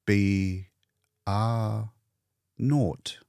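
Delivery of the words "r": ordinary delivery, nothing marked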